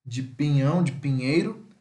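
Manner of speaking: ordinary delivery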